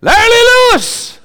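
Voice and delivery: high, drawn-out holler; calling out. Acted. Preacher shows how you call your dog, long high holler.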